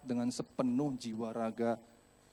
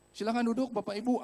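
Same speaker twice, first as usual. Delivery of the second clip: lightly voiced ride attendant, higher pitch; polite staff announcement, pitched up; 7 semitones higher than usual. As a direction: call riders to their seats like staff. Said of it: "ada petugasnya".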